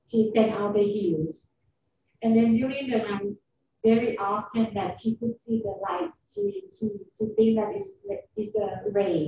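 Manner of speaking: speaking up over a bad line. Real, not acted. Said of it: "I can't hear you very well".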